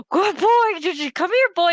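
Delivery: high, loud mocking puppy talk; mocking dog call. GM mimics the player calling the dog, high mocking puppy talk. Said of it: "and you're like".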